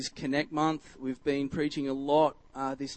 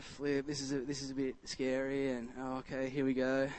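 quiet, mildly nervous self-talk; a bit nervous; 8 dB quieter than usual. Retold or imitated. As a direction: softly voice retold jitters. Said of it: "I was a bit nervous".

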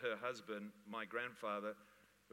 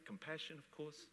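ordinary talk, nothing marked

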